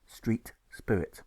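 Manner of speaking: ordinary delivery, nothing marked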